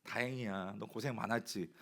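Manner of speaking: shepherd comforts the found sheep, softly and tenderly. Retold or imitated. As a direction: soothe a frightened lamb. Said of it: "토닥이면서".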